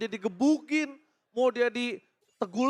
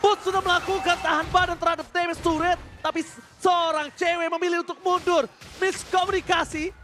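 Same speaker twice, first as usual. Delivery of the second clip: esports caster; 7 semitones higher than usual. Acted. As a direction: call the fight live, excited. Caster calls the fight again.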